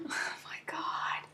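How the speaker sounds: breathless whispered gasp. Real, not acted. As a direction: whisper it in shock. Embarrassed by a chat message.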